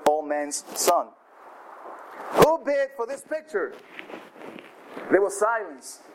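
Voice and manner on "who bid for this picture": auctioneer calling. Acted. Auctioneer calls for bids.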